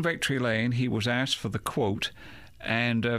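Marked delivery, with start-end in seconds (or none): none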